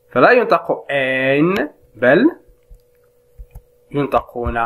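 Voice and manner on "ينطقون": ordinary talk, nothing marked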